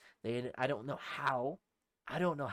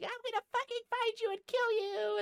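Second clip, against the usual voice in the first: very high screeching mock threat; imitated freak-out; 3 dB louder than usual. Girls hunting him behind the truck, imitated high screech. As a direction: mimic someone shrieking in a rage. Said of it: "they're, like, freaking the fuck out".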